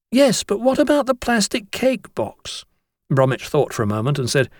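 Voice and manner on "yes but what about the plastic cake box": put-on high, slow, puzzled boy's voice; doubtful schoolboy. Boy worries about the missing cake box, high and slow. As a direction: slowly raise the snag in the plan.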